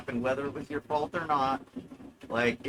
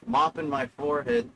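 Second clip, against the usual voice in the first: ordinary talk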